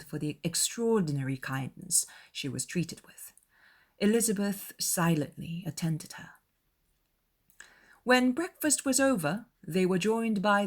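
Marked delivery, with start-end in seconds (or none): none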